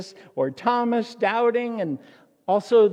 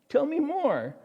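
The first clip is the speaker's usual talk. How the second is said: higher, playfully coaxing; coy curious Jesus; 8 semitones higher than usual. Acted. Jesus coaxing Cleopas, higher coy curiosity. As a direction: feign innocence, draw him out. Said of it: "And Jesus says".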